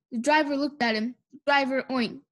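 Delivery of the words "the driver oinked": plain reading voice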